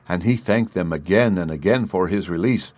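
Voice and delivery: ordinary delivery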